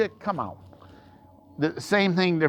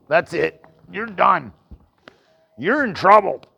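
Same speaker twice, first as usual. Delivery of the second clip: mock scolding; 5 semitones higher, 5 dB louder than usual. Real, not acted. Scolding a misbehaving horse.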